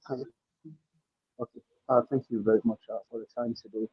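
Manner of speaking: barely audible, far from the mic; very quiet. Real, not acted. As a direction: speak faintly, away from the mic. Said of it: "Your voice is very low".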